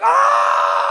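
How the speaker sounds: long, low, very loud scream; inner scream. Acted. Son screaming inside while outwardly okay, comic inner scream. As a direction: let out a huge drawn-out roar. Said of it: "on the inside, I was like".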